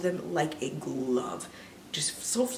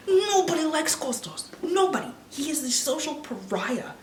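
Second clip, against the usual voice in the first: outraged rant; 9 semitones higher, 3 dB louder than usual. Real, not acted. Outraged ranting about movie.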